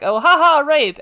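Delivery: high, loud, flippant mock laugh-line. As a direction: toss it off like it's a joke. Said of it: "it was like".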